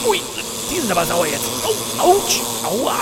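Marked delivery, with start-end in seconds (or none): 1.6-2.4 high yelp of pain; hammed-up ouch; cry of pain. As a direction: yelp as the hornet stings. Stung by angry hornets.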